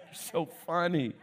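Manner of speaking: usual speaking voice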